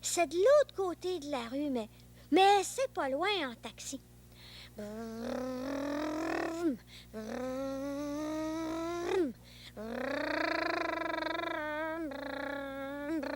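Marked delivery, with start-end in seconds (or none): 4.8-13.3 long lip-trilled car engine; engine noise. Pretend. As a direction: drive the taxi across the street. Boy imitates taxi engine.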